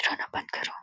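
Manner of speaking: very soft, voiceless whisper; barely audible whisper. A narrator's reading. Didi watching from the corner, barely audible whisper. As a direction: breathe it almost inaudibly.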